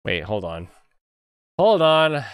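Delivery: ordinary talk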